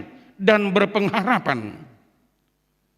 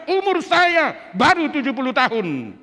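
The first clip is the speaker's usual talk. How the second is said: firm, raised proud statement of age; firm answer; 5 semitones higher than usual. Shown as a demonstration. Pastor modelling a firm reply for elders. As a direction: answer with confidence. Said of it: "jawab dengan tegas".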